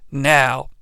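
usual speaking voice

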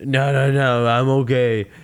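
lightly voiced slurring boxer; Rocky impression. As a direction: play a dazed fighter insisting he's fine. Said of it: "And he's like".

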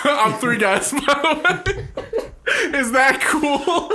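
high, slow, through laughter; laughing. Real, not acted. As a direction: drag it out while cracking up. Laughing hard at the joke.

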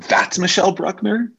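high, amazed question; stunned surprise. Retold or imitated. Seeing her future friend for the first time, high and amazed. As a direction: gasp out the name in disbelief.

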